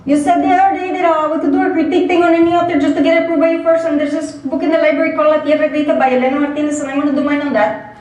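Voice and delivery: student pitching his report topic to the professor; eager student Beto. Acted. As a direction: tumble the words out like a nervous student. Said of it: "a student called Beto".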